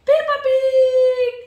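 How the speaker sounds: loud, slow crying wail. Real, not acted. Upset child demanding the Peppa Pig toy, crying loudly. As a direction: wail the words out through real tears.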